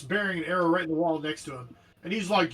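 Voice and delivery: normal talking voice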